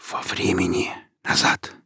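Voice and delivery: breathless hushed whisper; whispering with held breath. Acted. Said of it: "затаив дыхание, прошептал Уланов". (шёпот, затаив дыхание)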